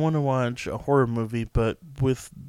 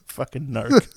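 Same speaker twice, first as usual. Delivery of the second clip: laughing; 2 semitones higher, 8 dB louder, slower than usual. Real, not acted. Laughing jab at a friend.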